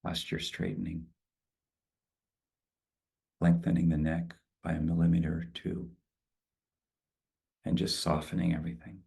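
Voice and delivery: calm, spacious guided meditation. Acted. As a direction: ease them into stillness. Leads a closing meditation.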